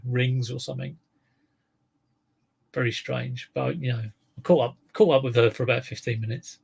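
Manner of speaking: ordinary talk, nothing marked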